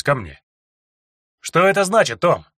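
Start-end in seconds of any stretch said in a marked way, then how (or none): 1.4-2.5 гневно. Acted. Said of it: "Гневно уперев руки в боки".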